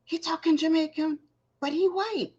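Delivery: put-on high, young child's voice, wide-eyed; little boy's voice. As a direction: play the young son, amazed. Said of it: "And he was like".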